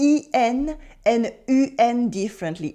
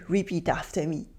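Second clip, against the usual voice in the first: usual speaking voice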